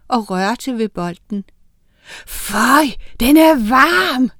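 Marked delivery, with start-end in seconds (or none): 2.3-4.3 high, loud cry, drawn out at the end; scream. A narrator's reading. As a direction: cry out, building to a slow, raised yell. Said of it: "skreg han".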